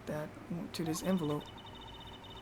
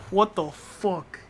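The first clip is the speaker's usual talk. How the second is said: slow stunned disbelief; much slower than usual. Real, not acted. Stunned by the character.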